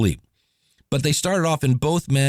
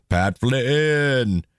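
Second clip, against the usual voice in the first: muffled behind hand. Retold or imitated. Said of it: "put my hand kinda over my mouth and went".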